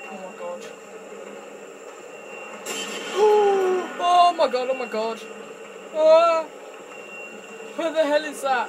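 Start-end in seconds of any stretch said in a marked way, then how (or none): 0.7-3.9 long, loud gasp of fright; high drawn-out gasp; terrified gasp. Real, not acted. Player startled by horror game. 4.0-8.7 loud, high cries of real fear; high frantic shouting; panicked yelling. Real, not acted. Player panics as monster appears.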